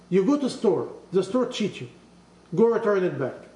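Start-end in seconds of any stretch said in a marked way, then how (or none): none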